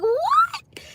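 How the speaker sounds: very high squeaked what; astonished what. Retold or imitated. Retelling her stunned reply, voice leaping very high. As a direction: shoot the word way up in disbelief. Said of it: "I was like".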